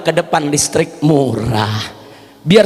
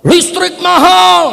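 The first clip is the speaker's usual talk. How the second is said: high, slow mock protest shout; fathers shouting; 10 semitones higher, 3 dB louder, slower than usual. Preacher imitating fathers protesting electricity prices, high slow shout. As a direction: yell it like angry fathers. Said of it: "Bapak-bapak teriak".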